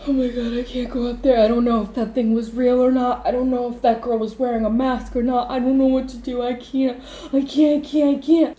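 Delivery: high, loud, spiralling panic; panicked refusal. Retold or imitated. Refusing to go back up there, panicked.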